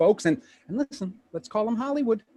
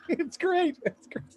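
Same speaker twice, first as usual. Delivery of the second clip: laughing; 12 semitones higher, 4 dB quieter, slower than usual. Acted. Laughing at friend's puppet humiliation.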